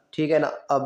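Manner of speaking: ordinary talk, nothing marked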